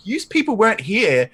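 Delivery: high, half-laughing disbelief; mock bewildered outburst. Asking the people in the queue where they came from, mock bewildered. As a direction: act amazed the crowd appeared.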